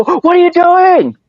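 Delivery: a horrified cry of disbelief; shocked alarm. Retold or imitated. Friends shocked as Rip grabs a full joint, high slowed alarm.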